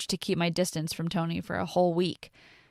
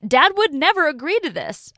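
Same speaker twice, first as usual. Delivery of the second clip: much higher and louder outburst; angry protest; 10 semitones higher, 9 dB louder than usual. Acted. Daughter refusing to believe her dad agreed, shouting. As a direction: fling it back at her in disbelief.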